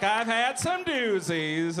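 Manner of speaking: high, slow mom voice. Acted. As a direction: draw it out boastfully as Mom. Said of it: "She's like".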